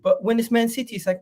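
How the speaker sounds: ordinary delivery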